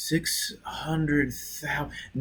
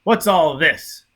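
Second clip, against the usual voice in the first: sharp, loud and slow demand; snapped; 4 semitones higher, 7 dB louder, slower than usual. A narrator's reading. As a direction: snap it out in irritation. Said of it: "he snapped".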